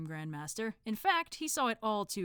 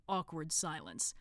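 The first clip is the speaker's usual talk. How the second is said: plain reading voice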